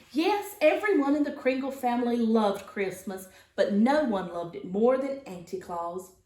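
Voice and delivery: plain reading voice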